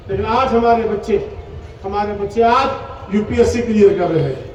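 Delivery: ordinary delivery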